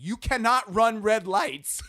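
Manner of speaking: put-on high, loud, slow little boy telling off; kid scolding. Four-year-old lecturing his dad about red lights.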